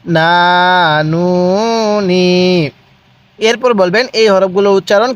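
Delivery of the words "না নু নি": "না, নু, নি" each held long and level; measured syllable chant of "না, নু, নি"; drawn-out vowels. Shown as a demonstration. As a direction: stretch "না, নু, নি" with pauses between. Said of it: "কিভাবে?".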